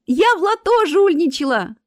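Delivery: high, loud, gleeful boast; joyful confession. A narrator's reading. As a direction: announce it proudly and happily. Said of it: "радостно ответила Марина".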